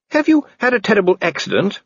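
a worried, well-bred woman asking gently; Lady Sybil. A narrator's reading. Lady Sybil voiced in the story.

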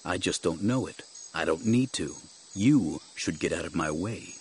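low, quiet menace; unyielding Jedi master. Acted. Jedi ordering guard out of his path, coolly firm. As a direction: warn him low and controlled.

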